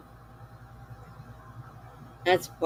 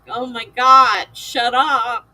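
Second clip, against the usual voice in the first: slow, much louder groan of annoyance; fed up with the cheery morning aide; exasperated; 4 semitones higher, 8 dB louder, slower than usual. Retold or imitated. Grumbling at her perky aide, loud mock exasperation. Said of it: "And I'm like".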